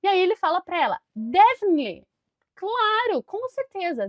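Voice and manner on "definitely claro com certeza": high, emphatic acted yes; keen, raised-pitch agreement; acted eager agreement. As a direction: play an enthusiastic reply for the class. Acting his eager yes to her.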